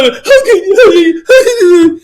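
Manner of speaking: high, slow, whimpering mock cry; mock whining. Mocking the customer with a slow, high whimpering cry. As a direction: whine it like a wounded victim. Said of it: "He's like".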